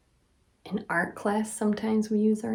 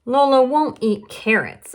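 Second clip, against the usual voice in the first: higher and louder, stressing Lola; lively read-aloud emphasis; emphatic storytelling; 6 semitones higher, 6 dB louder than usual. A narrator's reading. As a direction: tell it with big emphasis.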